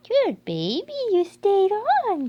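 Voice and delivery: loud, high, slow gushing praise. Real, not acted. Owner gushing praise at the puppy, high and slow.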